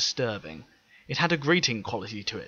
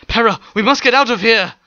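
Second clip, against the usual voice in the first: higher, louder alarmed urging; urgent fear; 11 semitones higher, 14 dB louder than usual. Acted. Teppel urging Pera to flee, frightened and urgent. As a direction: hurry her away in panic. Teppel urges escape in panic.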